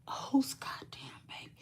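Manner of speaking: hushed, breathy retold gasp; whispered reaction. Telling viewers her shocked first reaction in a whisper. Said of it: "whispered retelling of her reaction".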